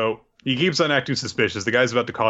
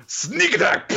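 pitched way up, playful; gleeful high exclaim; 14 semitones higher than usual. Retold or imitated. Recapping the ambush to friend, high and gleeful. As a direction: announce it like a gotcha.

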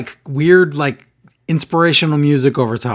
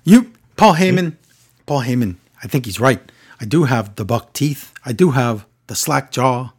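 a hint of Cesaro, deliberate and dopey; Cesaro impression. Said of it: "He was a little bit Pat Patterson at the end there".

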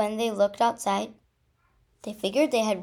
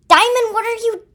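high, loud startled cry; startled question; 13 semitones higher, 14 dB louder than usual. Acted. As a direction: blurt it out loudly, high-pitched. Startled call, hushed by sister.

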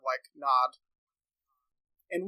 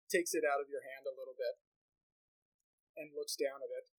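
ordinary talk

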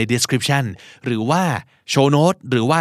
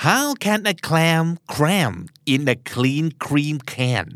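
very slow, word by word; slow careful drill; much slower than usual. Shown as a demonstration. Teacher working through the hardest twister, very slowly. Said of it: "อันนี้ยากสุดเลยอะ".

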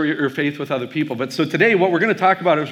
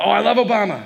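high-pitched adoring enthusiasm; imitated gushing; 7 semitones higher, 4 dB louder than usual. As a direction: gush like a delighted fan. Retold waitress gushing over Obama.

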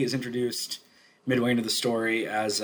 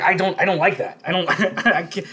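mock distress, raised and louder; flustered protest; 6 semitones higher, 5 dB louder than usual. Roommate's girlfriend rejecting the changing art, flustered. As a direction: imitate an upset complaint, laughing into it.

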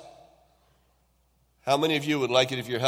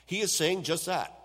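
normal talking voice

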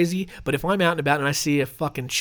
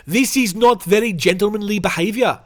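indignant South African, raised and loud; South African accent; 4 semitones higher, 5 dB louder than usual. Acted. Picnic victim complaining about the crocodile's theft, spluttering South African accent. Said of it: "spluttered Wall Hunter".

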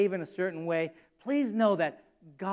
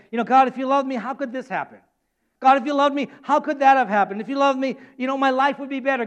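raised, pleading acted protest; impassioned mock complaint, higher and louder toward the end; aggrieved questioning; 4 semitones higher, 5 dB louder than usual. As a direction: challenge God, building to a loud final cry. Acted believer protesting to God.

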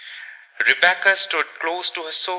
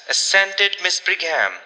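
ordinary delivery

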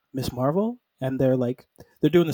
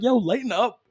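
higher, exclaimed mock protest; 6 semitones higher than usual. Everyone tells the angry robot to ease off, protesting. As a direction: call out a teasing objection.

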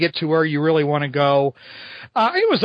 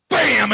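bam sound effect; 6 semitones higher than usual. Acted. Comic punch sound effect.

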